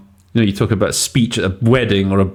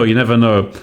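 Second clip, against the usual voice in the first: ordinary delivery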